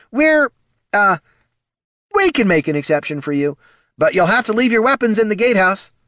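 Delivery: high, loud flustered voice; a rattled guard giving in; nervous guard. Acted.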